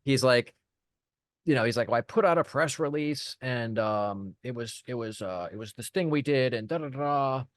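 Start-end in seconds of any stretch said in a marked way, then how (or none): 1.9-7.4 mock stumbling excuse, trailing into filler syllables; imitating stammering. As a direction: fumble through it with repeats and ums, imitating a flustered client. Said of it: "he's just completely stuttering and stammering".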